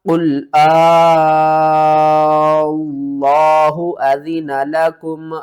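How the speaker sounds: slow, loud Quranic chant with a long madd; loud, slow tilawat; Quran recitation. Shown as a demonstration. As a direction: recite it, stretching Allah. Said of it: "کس طرح؟".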